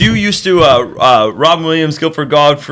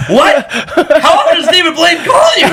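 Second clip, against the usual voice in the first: almost shouting in amused shock; excited disbelief; 10 semitones higher than usual. Retold or imitated. Teller asking his buddy about Blaine's calls, excited disbelief.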